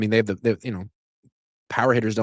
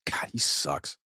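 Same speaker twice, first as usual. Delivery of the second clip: whispered and much softer, in disgust; 4 semitones higher, 11 dB quieter, slower than usual. Real, not acted. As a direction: breathe it out under your breath, fed up. Muttered disgust at the player.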